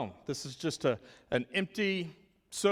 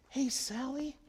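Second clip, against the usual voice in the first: softer, higher gentle greeting; calm voice; 9 semitones higher, 4 dB quieter than usual. Shown as a demonstration. Teacher calming a worked-up four-year-old, soft and gentle. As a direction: soothe her gently, like calming a child. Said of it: "I'm gonna talk in a calm voice".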